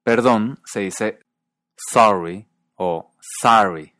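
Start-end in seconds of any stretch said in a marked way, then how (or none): 1.8-3.9 "sorry" with open vowel, second one as sahry; Northeastern US pronunciation. Shown as a demonstration. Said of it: "En el noreste de Estados Unidos".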